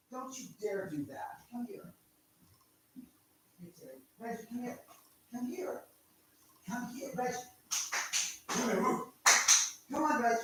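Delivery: soft, high pet-talk coaxing; quiet high coax to a dog who won't come. Real, not acted.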